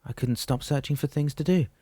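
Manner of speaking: high, eager recollection; excited. A narrator's reading. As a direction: recall it with enthusiasm. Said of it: "excited now".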